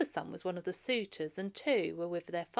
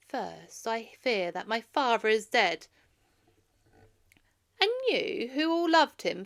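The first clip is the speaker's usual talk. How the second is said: loud, higher anguished plea to the assembly; young prince pleading loudly before the assembly; young man pleading; 4 semitones higher, 9 dB louder than usual. Acted. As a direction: cry out your troubles to the crowd. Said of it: "Telemachus stood up in his place and said".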